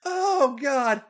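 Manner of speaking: very high, loud outcry; acted overwhelmed yell; crying out. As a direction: exclaim it at full pitch. Character cries out, as before.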